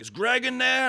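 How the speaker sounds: loud, high-pitched call from outside; mock armed-man demand; imitated demanding shout. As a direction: holler it up high like an angry visitor. Armed men pull up demanding Greg.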